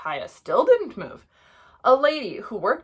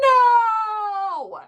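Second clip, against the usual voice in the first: high, long, loud shout; full-out storybook yell; group yell; 12 semitones higher, 6 dB louder than usual. A narrator's reading. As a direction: bellow a drawn-out refusal. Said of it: "all yelled".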